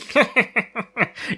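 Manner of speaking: high, hammed-up villain cackle; mock evil laugh. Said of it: "cackling away".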